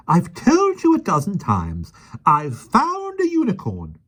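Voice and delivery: high, slow, patient-but-fed-up voice; exasperated lady. A narrator's reading. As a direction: spell it out for him slowly. Miss Lark exasperated.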